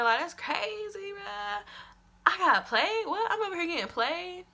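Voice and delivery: can't believe she got played; incredulous. Disbelief at being played.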